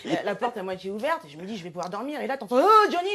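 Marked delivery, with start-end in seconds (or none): 2.6-3.1 high, loud rock singer cry. Retold or imitated. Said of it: "je suis pas imitatrice".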